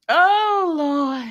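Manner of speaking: high, laughing oh Lord; amused exclamation. Real, not acted. Winding down from laughing at her own joke, high and amused. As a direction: let the laugh carry the words up high.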